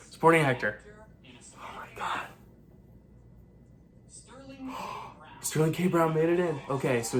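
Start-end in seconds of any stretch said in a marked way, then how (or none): 1.6-2.3 breathless whispered gasp; whispering. Real, not acted. Whispered reaction to nominations. 4.5-5.1 quick breathless gasp of shock; sharp gasp. Real, not acted. Reaction to nomination reveal.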